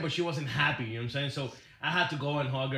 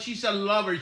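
ordinary talk

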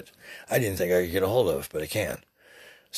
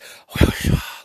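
wordless whisper under the breath; 4 dB louder than usual. Shown as a demonstration. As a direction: breathe out a secret word, voiceless. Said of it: "whisper that under my breath like this".

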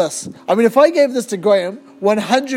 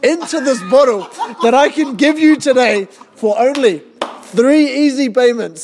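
home shopping host. Acted. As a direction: build the pitch like a TV sale. Parody infomercial sales pitch.

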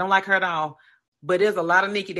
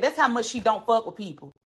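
ordinary talk, nothing marked

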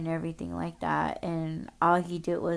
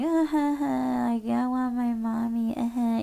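mock crying kid; 6 semitones higher, 3 dB louder than usual. Mocking a kid who wants mommy, in exaggerated high sobs. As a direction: whine and boo-hoo to tease a crybaby. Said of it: "if there was a kid that was crying".

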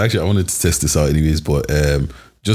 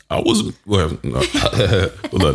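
normal talking voice

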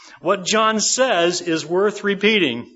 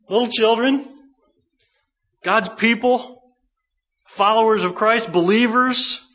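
raised, ringing pulpit appeal to the flock; fervent preaching; 5 semitones higher than usual. Acted. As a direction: call out to them like a preacher stirring his church.

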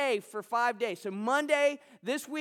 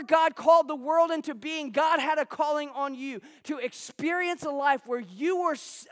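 rising preacher's fervor, louder and higher; preaching; 3 semitones higher, 4 dB louder than usual. Acted. Pastor to his congregation, preaching with rising fervor. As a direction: preach it out with mounting conviction to the room. Pastor builds sermon to full force.

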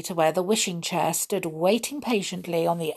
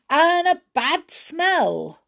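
disgusted; 9 semitones higher, 4 dB louder, slower than usual. A narrator's reading. Disgust at the bad smell.

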